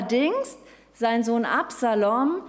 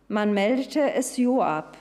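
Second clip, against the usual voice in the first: usual speaking voice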